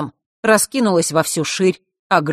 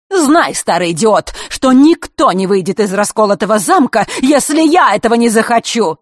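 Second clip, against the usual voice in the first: loud, raised-pitch defiant threat; angry threat; 5 semitones higher, 6 dB louder than usual. A narrator's reading. Elena warns the old man he is trapped, loud and angry. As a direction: throw the insult out loudly.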